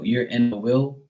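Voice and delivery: ordinary talk, nothing marked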